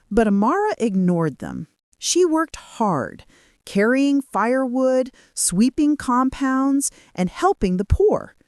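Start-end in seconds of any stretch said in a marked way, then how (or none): none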